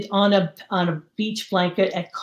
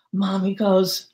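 ordinary talk

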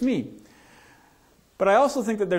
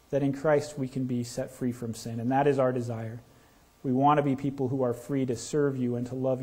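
prayer; 4 semitones lower than usual. Acted. Pastor prays before the reading.

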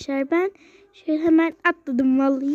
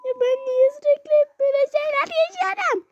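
exasperated whine; 10 semitones higher, faster than usual. Acted. Exasperated complaint.

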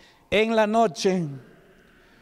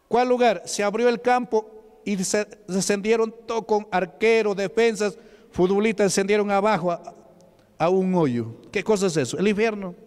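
fiery preaching; 4 semitones higher than usual. Acted. Preacher drives home hell point.

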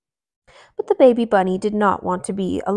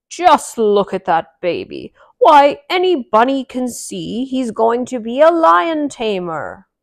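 granddaddy; 3 semitones higher, 6 dB louder than usual. Acted. Granddaddy bunny voiced.

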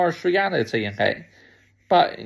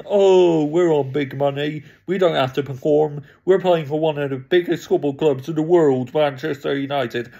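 smug, sneering mimic of pampered players; mock their entitled attitude. Fan mimics overpaid United players, sneering mockery.

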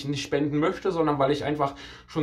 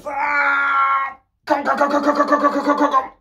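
higher, louder strained yelling; straining shout; 9 semitones higher, 6 dB louder, slower than usual. Real, not acted. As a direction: push through the last reps, yelling. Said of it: "so rumhecheln".

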